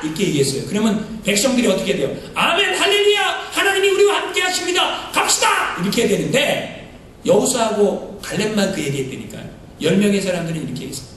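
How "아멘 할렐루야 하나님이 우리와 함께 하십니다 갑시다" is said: high excited amen and hallelujah; mock cheering crowd. Preacher voicing the people answering Joshua, excited cheer.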